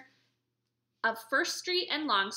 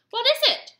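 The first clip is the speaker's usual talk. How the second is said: eager question; 11 semitones higher, 10 dB louder than usual. Acted. Officer asks eagerly.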